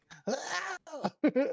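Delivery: high-pitched imitated imp screech; little screech. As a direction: let out a small, high screech. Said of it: "this screech goes swinging in front of you".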